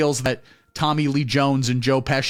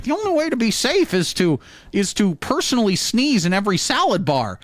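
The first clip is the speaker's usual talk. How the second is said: high, hammed-up preachy voice; mock earnest health advice; 7 semitones higher than usual. Mocking pandemic preachers, hammed-up high and preachy. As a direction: squeak it out like a panicked safety nut. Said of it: "people going out there being like".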